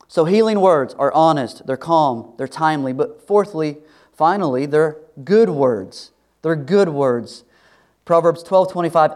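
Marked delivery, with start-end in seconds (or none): 4.7-7.4 slow emphasis. Shown as a demonstration. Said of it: "repeated slow emphasis".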